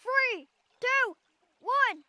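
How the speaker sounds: shouted countdown, slow at the end; loud countdown. Real, not acted. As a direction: call out the countdown loudly. Countdown before driving the Jeep.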